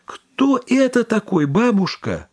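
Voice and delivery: plain reading voice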